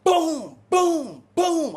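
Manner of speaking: loud imitated kicks on the door. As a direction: slam each boom like a kick on a cell door. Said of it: "I start kicking the door down".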